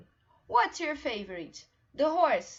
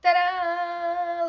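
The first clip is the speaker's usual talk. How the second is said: loud, higher reveal; proud ta-da; 6 semitones higher, 9 dB louder than usual. Real, not acted. Teacher showing her drawing to the class. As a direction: show it off proudly.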